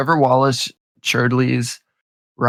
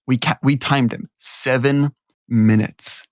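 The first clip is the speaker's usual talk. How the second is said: ordinary delivery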